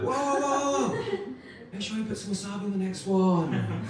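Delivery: high-pitched comic "hold on"; mock alarmed interjection. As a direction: cut in high, as if stopping a diner. Acted reaction to sushi in ashtray.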